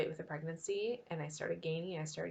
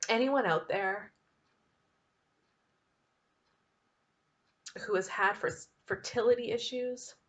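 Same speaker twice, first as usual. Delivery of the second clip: getting emotional. Real, not acted. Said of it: "I'm gonna get emotional".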